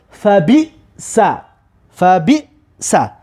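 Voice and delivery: "فا بی سا" slowly, pause before "سا"; syllables sounded out. Shown as a demonstration. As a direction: sound out "فا بی سا" with a break before the end.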